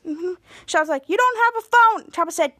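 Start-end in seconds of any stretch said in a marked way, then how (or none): 1.1-2.1 louder, higher acted protest; calling out objection. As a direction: call it after a departing friend. Shadow calls after departing Chopper.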